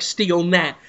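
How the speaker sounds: ordinary delivery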